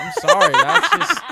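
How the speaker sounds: high, loud laughing apology. Real, not acted.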